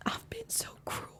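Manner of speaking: high, soft, breathless whisper; near-silent confession of cruelty; whispered remorse. Acted. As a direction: let the words almost vanish. Breaking down over her marriage.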